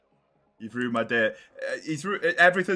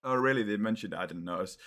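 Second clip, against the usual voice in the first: ordinary talk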